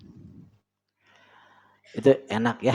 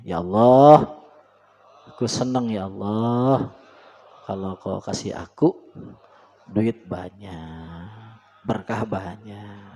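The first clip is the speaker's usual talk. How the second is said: calm firm prayer; 5 semitones lower, slower than usual. Shown as a demonstration. Preacher showing a firm, unhurried prayer to God, calm. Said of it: "yang penting itu dimantepnya".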